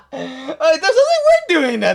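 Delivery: laughing through the words, high and loud; can't stop laughing while talking. Real, not acted. Player laughs over the judge's line, giggling.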